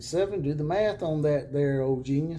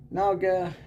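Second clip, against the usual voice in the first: loud; 7 semitones higher, 6 dB louder than usual. Real, not acted. Addressing troll directly.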